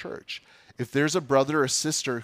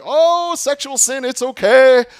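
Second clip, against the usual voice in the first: much higher and louder, a mocking carefree brag; mock boasting; 12 semitones higher, 12 dB louder than usual. Preacher mocking the boasting Corinthian church, hammed-up brag. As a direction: lampoon their smug shrug. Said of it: "they were boasting in their".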